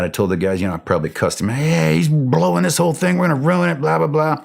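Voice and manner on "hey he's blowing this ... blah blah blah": higher, heated retelling of a rant; angry rant. Retold or imitated. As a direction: vent it up high, mock furious. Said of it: "I was so mad".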